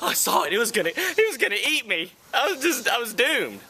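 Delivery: high, breathless hammed-up fright; mock panic. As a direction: babble in fake terror. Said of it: "scares me".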